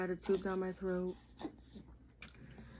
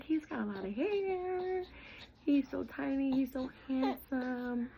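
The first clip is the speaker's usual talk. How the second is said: high, crying while quoting husband on their son; tearful quote; 7 semitones higher, slower than usual. Real, not acted. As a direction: let the tears through, slowly. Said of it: "He was like".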